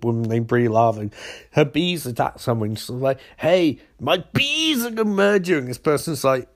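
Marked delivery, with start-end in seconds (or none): 3.4-5.6 mock villain scream. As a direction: imitate a screamed threat, pitch raised. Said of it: "she screams down microphone".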